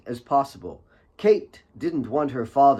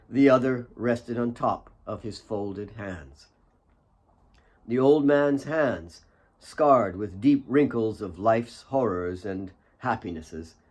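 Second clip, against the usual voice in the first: plain reading voice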